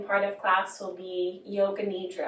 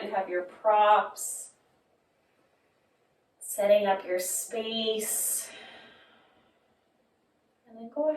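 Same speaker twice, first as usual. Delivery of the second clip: guided yoga relaxation. Acted. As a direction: guide them gently into rest, phrase by phrase. Leads gentle yoga relaxation.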